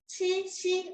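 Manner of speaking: 치 and 시 held on a high, level tone; first-tone syllables. Shown as a demonstration. Teacher moving on to the next syllables, high level 치, 시. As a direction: keep 치, 시 even and high, with a plain 이 vowel. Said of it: "일성으로 읽으면".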